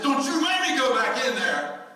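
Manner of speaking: high, loud, slow whine; mock exasperated high whine; whiny son. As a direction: protest like a fed-up young man. Son complaining, put-on voice.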